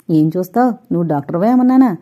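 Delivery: plain reading voice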